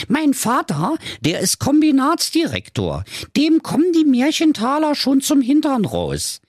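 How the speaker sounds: very high-pitched, boastful princess; high, emphatic spoilt daughter; Carola Knackwurst. Acted. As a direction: brag in a squeaky-high fairy-tale diva voice.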